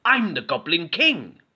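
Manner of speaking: high, proud goblin. A narrator's reading. Goblin wearing the crown, high proud boast. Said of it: "said Goblin".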